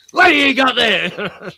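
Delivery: high, loud accusing challenge; suspicious shop worker. Retold or imitated. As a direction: pounce on a suspected shoplifter. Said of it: "pretend I was shoplifting".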